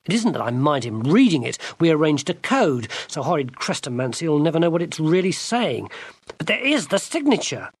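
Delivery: high, rising self-pity and rage; indignant rant. Acted. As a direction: rant about the injustice. Gwendolen ranting.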